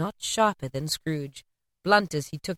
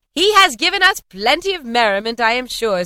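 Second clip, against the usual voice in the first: higher, louder jolly voice; merry toast; 5 semitones higher, 7 dB louder than usual. A narrator's reading. Fred toasting his uncle to the guests, merry and loud. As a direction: raise the room in good cheer. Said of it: "said Fred".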